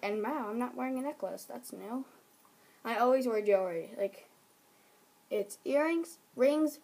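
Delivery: ordinary talk, nothing marked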